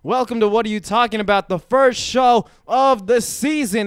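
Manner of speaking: loud, fast announcer opening; show host hype. Acted. Host opens the season's first show with loud hype. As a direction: welcome them like a big show.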